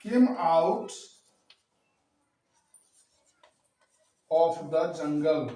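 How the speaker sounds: an elephant read very slowly, word by word; slow dictation. Shown as a demonstration. Teacher dictating the English example sentence slowly. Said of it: "एक और example देखिए".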